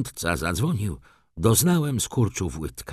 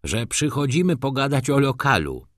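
higher-pitched, mildly testy reply; irritated; 6 semitones higher than usual. A narrator's reading. Steward giving the maid a message, mildly irritated. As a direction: explain with a touch of impatience. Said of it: "odparł już zirytowany rządca".

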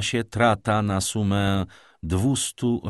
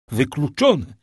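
louder, higher insistent repeat; emphatic exclamation; 6 semitones higher, 6 dB louder than usual. A narrator's reading. Father refuses to believe the miracle, loud exclamation. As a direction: throw the word out forcefully.

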